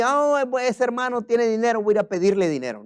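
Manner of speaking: breezy, careless inner voice; mock casual thought. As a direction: toss it off lightly, fast. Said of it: "Yo no fui". (pensamiento burlón y despreocupado)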